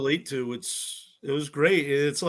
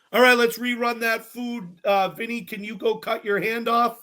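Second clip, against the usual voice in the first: higher-pitched, breezy director's instructions; imitation of crew directions; mock TV director; 7 semitones higher than usual. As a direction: give absurd orders like a casual director. Mocks staged reshoot directions.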